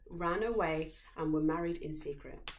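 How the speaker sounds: ordinary delivery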